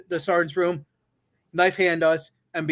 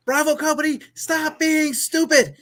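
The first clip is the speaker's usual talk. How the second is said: high, slow, drawn-out command to the company; high, slow emphasis on stupid; drill sergeant yell; 11 semitones higher than usual. Retold or imitated. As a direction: bark it like a sergeant who's had enough. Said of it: "the emphasis on it was so real".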